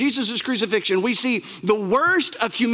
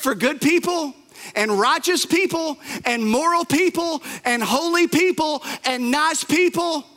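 lightly voiced thief, raised and pleading; the thief calls out the other criminal, a little higher; thief's rebuke; 5 semitones higher than usual. Preacher voicing the thief who rebukes the other criminal, raised. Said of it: "In other words".

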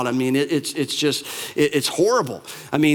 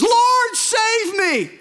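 panicked cry; much slower than usual. Acted. Sinking Peter crying out to Jesus, panicked high shout. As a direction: cry out as if going under. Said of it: "he panics".

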